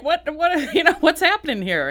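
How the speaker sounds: imitating a viewer suddenly singled out, high and amused; mock startled viewer. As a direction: play the startled viewer, half laughing. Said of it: "start calling out the viewers like".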